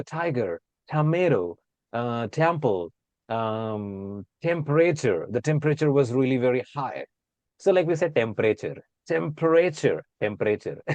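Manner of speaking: tiger, tomato with aspirated American T and flapped tomato; American accent. Shown as a demonstration. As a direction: soften the T the American way. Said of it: "But in America".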